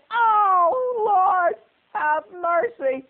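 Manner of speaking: high wailing sob, mother in despair; screaming and crying. Retold or imitated. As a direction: wail it through tears, pitch way up. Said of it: "started screaming".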